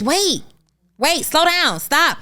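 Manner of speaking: high, urgent parental warning; parent halting kids. Acted. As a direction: stop the kids before they fall. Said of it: "say that to our kids".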